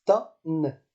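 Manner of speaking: "ta-n" slowly split, "ta" then a held n; slow "ta-n" with the final n added; doubled accent pronunciation. Shown as a demonstration. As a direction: sound out "ta-n" in two parts. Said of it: "je le prononce".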